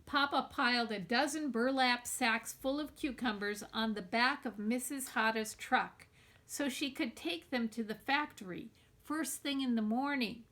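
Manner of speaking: plain reading voice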